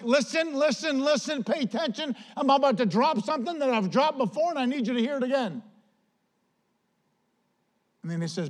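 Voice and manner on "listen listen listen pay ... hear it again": high-pitched plea for attention; urgent apostle. Retold or imitated. Apostle about to repeat a warning, urgently insistent. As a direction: urge them to listen, pitch held high. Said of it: "For Paul is like".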